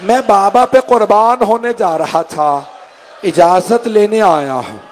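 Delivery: wailing plea. Acted. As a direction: plead through tears for the father. Grieving plea, pitch raised.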